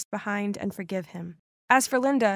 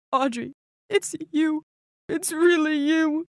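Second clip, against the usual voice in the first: higher, drawn-out voice of an overcome father; choked up with recognition, pitched up; tearful; 5 semitones higher than usual. A narrator's reading. Father hugging his long-lost daughter, tearful. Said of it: "he started crying and hugging me".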